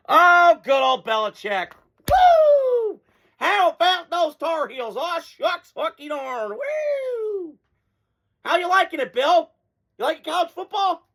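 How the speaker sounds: high, whooping mock cheer that taunts Bill; gloating mockery. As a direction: crow over his losing in a high mocking voice.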